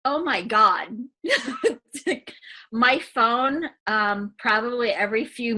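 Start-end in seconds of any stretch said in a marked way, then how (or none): 0.9-2.3 a high burst of real laughter. Real, not acted. Remembering her phone buzzing nonstop, bursting into laughter. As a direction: let a real laugh escape.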